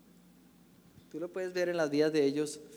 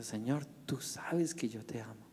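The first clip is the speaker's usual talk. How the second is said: whispered, lower and softer; saddened whisper; 5 semitones lower, 7 dB quieter than usual. Acted. Grieved Peter whispers his love to Jesus. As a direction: breathe it out in sorrow. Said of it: "se entristeció mucho Pedro".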